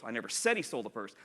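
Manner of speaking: emphasize said as the contrasted word; stress on said. Shown as a demonstration. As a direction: lean on the word said. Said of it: "they have six different meanings".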